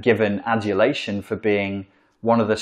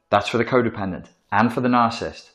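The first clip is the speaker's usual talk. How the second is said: ordinary delivery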